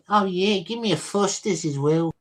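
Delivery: a cheerful Aussie ordering a Foster's; Australian accent. Pretend. Streamer extending the Aussie bit for chat, Australian accent. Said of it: "my Australian impression".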